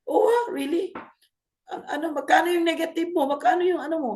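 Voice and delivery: high-pitched, amused show of concern; mock amazement. Coach questioning a sleepless student about losses, mock amazement.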